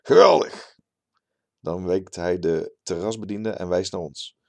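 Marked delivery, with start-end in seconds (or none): 0.0-0.8 a much higher, much louder, jubilant "Geweldig"; laughing, exuberant. A narrator's reading. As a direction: burst out with delight. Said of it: "De terrasbioloog lacht en zegt".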